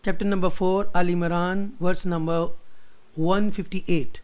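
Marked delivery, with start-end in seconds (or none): none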